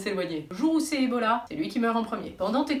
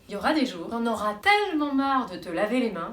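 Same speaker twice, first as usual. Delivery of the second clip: normal talking voice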